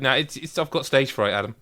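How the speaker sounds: normal talking voice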